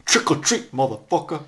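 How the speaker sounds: high, loud sing-song trick or treat; mock trick-or-treat threat. Joking to a friend while watching a horror film, mock menacing greeting. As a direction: leer it out like a masked killer at the door.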